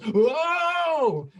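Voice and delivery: high, loud astonished whoa; imitating a stunned crowd; mock crowd whoa. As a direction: gasp out a shocked whoa like a room. Whole room reacting, retold.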